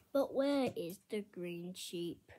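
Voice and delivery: normal talking voice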